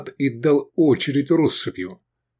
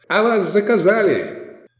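high, drawn-out jolly call; merry sing-out; cheery taunt; 11 semitones higher, 3 dB louder than usual. A narrator's reading. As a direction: announce it gleefully, pitched up. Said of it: "весёлый баритон".

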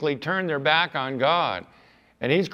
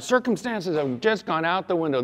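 ordinary talk, nothing marked

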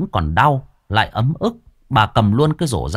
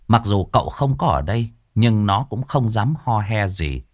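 plain reading voice

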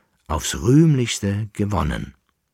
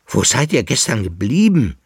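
louder, higher urgent question; calling out; 4 semitones higher, 6 dB louder than usual. A narrator's reading. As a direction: demand an answer, voice raised. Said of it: "rief er". (aufgeregt gerufen)

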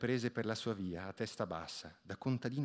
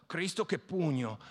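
grumbling at the blow; 5 semitones higher than usual. A narrator's reading. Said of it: "borbottò".

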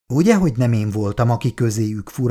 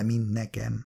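ordinary delivery

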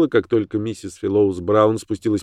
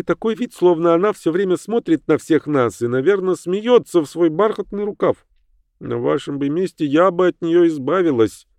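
higher voice for the visiting lady; lady's voice, raised pitch; 8 semitones higher than usual. Acted. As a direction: voice her higher and uneasy. Mrs Fallows Brown voiced higher.

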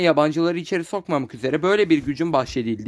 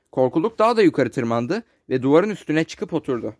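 ordinary delivery, nothing marked

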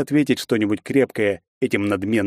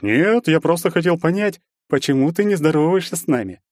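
mock innocence; 8 semitones higher than usual. A narrator's reading. Bully keeps feigning innocence.

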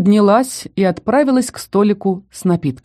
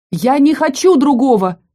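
indignant cry; 6 semitones higher, 3 dB louder than usual. A narrator's reading. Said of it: "От негодования я взвилась".

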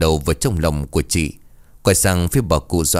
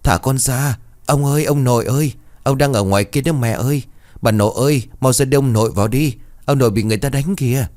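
put-on higher, urgent little boy's voice; mild wail of a child pleading with grown-ups; crying-out child; 6 semitones higher than usual. A narrator's reading. Child begging family to rescue his grandfather, crying out. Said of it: "miệng nó không ngừng gào lớn".

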